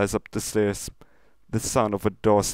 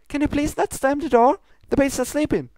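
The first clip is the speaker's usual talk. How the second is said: higher, polite hushed request; lightly voiced Pinkie; 6 dB louder, faster than usual. Pinkie asking the commander not to slam the door, sweet and high. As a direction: ask sweetly, a bit up high.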